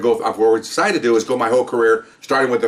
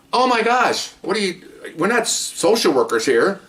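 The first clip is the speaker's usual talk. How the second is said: exasperated outburst; 9 semitones higher than usual. Real, not acted. Outraged at the sergeant.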